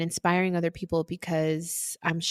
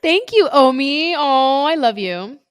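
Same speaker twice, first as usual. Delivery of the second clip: high, laughing, drawn-out affection; gushing thanks; 7 semitones higher, 4 dB louder, slower than usual. Real, not acted. Host thanking her assistant for the tribute, gushing. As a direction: gush with delight, stretching the words.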